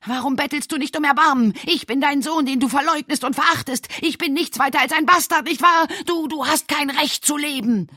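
high, loud bitter tirade; disowned son screaming at his father, raging. Acted. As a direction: hurl every word at him in fury. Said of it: "tobte Edgar schreiend".

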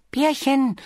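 high, light bee voice; little bee character. Acted. Bee reminds Bear what bears can't do, cautioning. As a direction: voice a small bee, patiently cautioning.

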